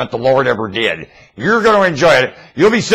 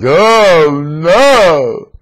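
dismayed Mrs Clinton; 6 semitones higher, 8 dB louder than usual. Retold or imitated. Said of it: "the look on her face".

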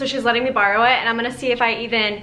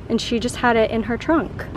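usual speaking voice

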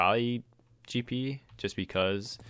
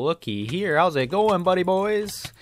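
higher, louder silly greeting voice; goofy put-on voice; 10 semitones higher, 11 dB louder, slower than usual. Player taunting rival team in a silly high voice. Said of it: "we're done talking like this".

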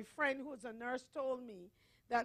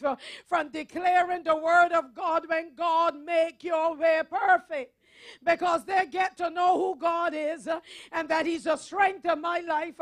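forceful, declarative sermon delivery; preaching; 5 semitones higher, 15 dB louder than usual. Acted. Preacher telling the congregation of Paul before Nero, forceful preaching. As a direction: preach it with hard-hit key words and firm pauses.

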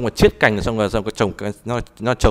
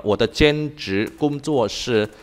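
drawn-out, syllable-by-syllable Chinese; slow dictation; much slower than usual. Shown as a demonstration. Teacher giving the student a sentence to type, slowly. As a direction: dictate it slowly so it can be typed. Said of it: "giờ em gõ câu này vào đi".

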